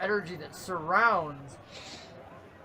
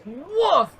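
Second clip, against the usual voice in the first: mock grunt; 8 semitones higher, 4 dB louder than usual. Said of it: "is like".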